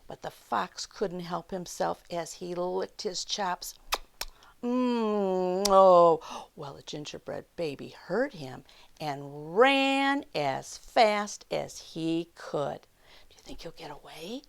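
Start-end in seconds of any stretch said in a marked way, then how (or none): 4.6-6.2 hungry fox lip-smack then a loud oh. Acted. Fox licking his chops, a loud greedy oh. 13.3-14.4 quick hushed whisper; suspense whisper. A narrator's reading. Asking the children, a fast suspenseful whisper. Reader asks kids in suspense.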